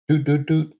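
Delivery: high playful doot sounds; mock beeping. Player mimicking the game's download beeps, playful. Imitating a data transfer.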